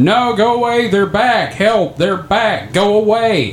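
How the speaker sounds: lightly voiced frightened old woman; old woman yelling. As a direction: imitate the panicked neighbor calling for help. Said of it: "old woman ... start yelling".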